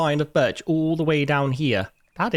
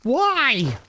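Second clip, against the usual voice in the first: exasperated scolding; 11 semitones higher than usual. Real, not acted. Scolding imagined lazy worker.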